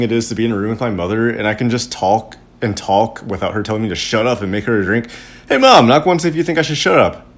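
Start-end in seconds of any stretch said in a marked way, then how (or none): 5.5-7.3 louder, higher mock call-out; raised-voice, goading question; calling to Mom. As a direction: call out to an unresponsive mother. Calling out to mother's coffin.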